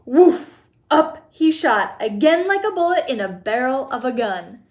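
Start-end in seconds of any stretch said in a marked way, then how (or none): none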